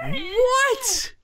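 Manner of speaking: very high, stunned question; shocked. Real, not acted. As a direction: squeak it in disbelief. Shocked reaction.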